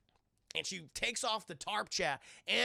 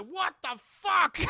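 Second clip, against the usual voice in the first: shocked shout; 10 semitones higher, 9 dB louder, slower than usual. Retold or imitated. Crew sees eyeless wife.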